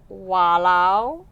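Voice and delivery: Walao as wah-lau, rising; Singaporean accent. Shown as a demonstration. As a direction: Singlish exclamation walao. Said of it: "Walao is super, super versatile".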